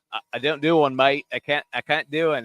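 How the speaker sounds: mock Australian twang; Australian accent. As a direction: put on an Australian voice. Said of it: "do you do an Australian accent".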